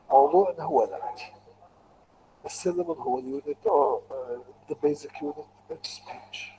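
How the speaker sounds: ordinary talk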